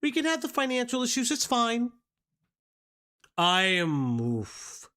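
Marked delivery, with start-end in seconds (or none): 0.0-1.9 higher, fast, sing-song mock of the girlfriend; mocking her reply. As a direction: parody her overly accepting answer. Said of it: "her first response is".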